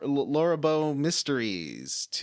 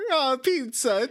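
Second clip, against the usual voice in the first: put-on Italian accent; 13 semitones higher, 7 dB louder, slower than usual. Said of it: "that was Italian".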